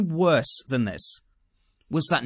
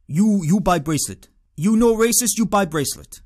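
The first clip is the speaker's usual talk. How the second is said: louder, higher mock foreign accent; accusing street seller with an imitated foreign accent; pushy foreign accent; 5 semitones higher, 4 dB louder than usual. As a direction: badger them in a put-on foreign accent. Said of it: "excuse my horrible accent".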